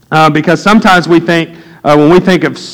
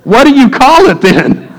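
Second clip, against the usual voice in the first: higher, disbelieving challenge; incredulous; 8 semitones higher than usual. Retold or imitated. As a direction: friend who shoplifted, asked in raised disbelief. Incredulous retort to friend.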